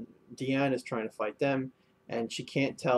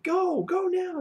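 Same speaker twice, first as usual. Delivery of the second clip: squeaky high-pitched embarrassed order; high flustered voice; 4 dB louder, slower than usual. Retold or imitated. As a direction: shoo them off in a high squeak. Embarrassed knight ordering soldiers.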